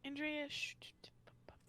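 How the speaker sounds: whispering. Real, not acted. Sneaky whisper to co-host.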